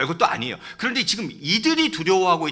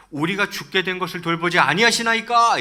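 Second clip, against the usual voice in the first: loud, raised-pitch acted complaint; reproachful plea; 5 semitones higher, 7 dB louder than usual. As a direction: cry out as disciples blaming their teacher. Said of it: "이거 약간 원망 섞인 어투죠". (원망 섞인 어투로 크고 높게)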